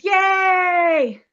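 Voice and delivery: loud, high cheer; wrapping up the Purim story with a loud cheer. Real, not acted. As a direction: celebrate the happy ending out loud.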